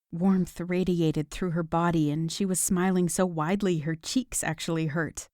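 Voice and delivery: ordinary delivery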